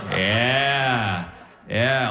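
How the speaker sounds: ordinary talk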